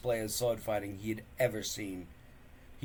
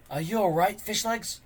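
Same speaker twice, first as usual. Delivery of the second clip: concerned; 10 semitones higher, 5 dB louder than usual. Acted. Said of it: "asked Hiccup with concern".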